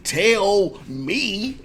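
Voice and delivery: high, very slow, laughing through it; laughing sass. Shown as a demonstration. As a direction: tease him with a laugh. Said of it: "And then laugh at him".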